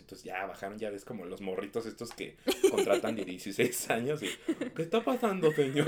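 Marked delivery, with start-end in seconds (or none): 4.8-5.9 put-on high, loud young usher asking what's up; hammed-up teenage cinema worker. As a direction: play the nervous sixteen-year-old usher. Teen cinema staff voiced.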